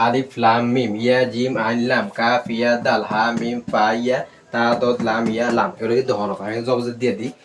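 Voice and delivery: ordinary talk, nothing marked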